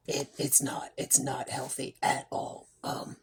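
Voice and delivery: whispered through throat pain; breathy, aching whisper; barely voiced, throat hurting; real whisper. Real, not acted. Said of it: "a thousand needles".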